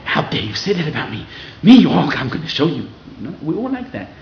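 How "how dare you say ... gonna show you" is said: building to a loud, offended cry; hammed-up wounded pride, getting louder; mock indignant outburst. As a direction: take the criticism as an insult and hit back. Acting a defensive reaction to criticism.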